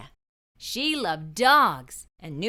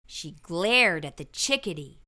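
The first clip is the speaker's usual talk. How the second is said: usual speaking voice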